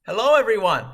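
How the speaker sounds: ordinary talk, nothing marked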